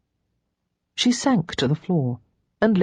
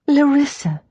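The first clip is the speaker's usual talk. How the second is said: glad surprise; 10 semitones higher, 5 dB louder than usual. Acted. Sister greets arriving Lara.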